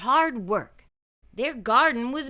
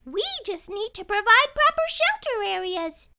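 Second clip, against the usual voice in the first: put-on high, knowing girl's voice; lightly voiced know-it-all girl; explaining; 12 semitones higher than usual. A narrator's reading. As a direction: explain it patiently. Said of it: "she explained".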